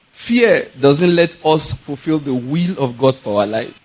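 ordinary talk

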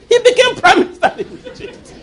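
high laughing delivery of the punchline. Real, not acted. Preacher laughing at the Joseph reading, high. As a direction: laugh through the line.

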